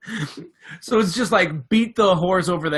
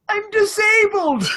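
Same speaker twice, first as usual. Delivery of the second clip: much higher, slow put-on squeaky voice; high-pitched fake voice; 8 dB louder than usual. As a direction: fake a strained high voice to fool them. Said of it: "He's using that high-pitched voice the whole time".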